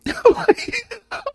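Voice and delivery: shrill burst of laughter; high laugh. Real, not acted. Hearing about the brawl, high burst of laughter. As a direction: crack up.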